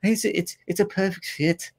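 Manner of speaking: high, soft mock Scouse; put-on Liverpool lilt; Liverpool accent. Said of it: "the Liverpool accent".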